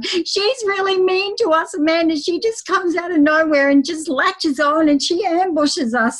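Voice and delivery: high, plaintive little dogs tattling; whiny dog voice. Retold or imitated. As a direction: squeak it out like hurt puppies. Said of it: "the two dogs are going".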